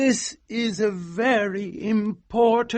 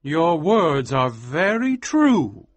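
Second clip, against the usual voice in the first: sadly; 5 semitones higher, 5 dB louder, slower than usual. Acted. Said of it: "said Almer sadly".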